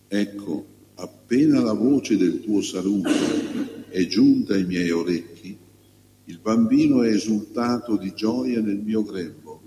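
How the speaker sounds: measured, slightly lifted liturgical reading voice; solemn church lectern reading; Gospel reading. Real, not acted. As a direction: proclaim scripture phrase by phrase, with clear pauses. Proclaims the Gospel before the homily.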